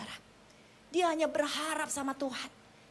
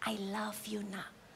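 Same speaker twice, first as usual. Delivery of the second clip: soft, low murmur; tender; 4 semitones lower, 9 dB quieter, slower than usual. Retold or imitated. Jesus telling a fallen man he is loved, tender hush. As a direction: say it hushed, dropping away gently.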